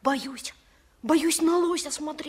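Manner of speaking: soft, timid voice; scared. Acted. Boy hesitates near the dead moose: quiet fear. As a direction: hold back, frightened.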